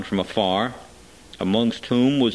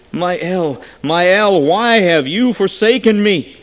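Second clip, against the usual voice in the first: higher, louder pleading cry; anguished cry; 7 semitones higher, 7 dB louder, slower than usual. A narrator's reading. Dying man crying out to God, high and loud.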